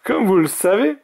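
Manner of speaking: high, emphatic mage exclamation; exclaiming. A narrator's reading. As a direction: exclaim it to the whole crowd. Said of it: "s'exclama le grand mage".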